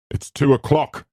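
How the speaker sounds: high-pitched housekeeper's announcement; curt housekeeper announcing. Acted. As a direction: announce the hour from the doorway. Mrs Dudley announces from the doorway.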